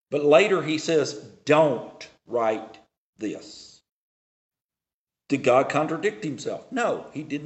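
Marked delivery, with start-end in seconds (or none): 1.4-3.8 very slow, word by word; deliberate quoted command. Retold or imitated. Preacher quoting God's command not to write, slow and deliberate. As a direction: space out each word of the command.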